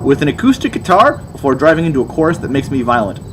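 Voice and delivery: usual speaking voice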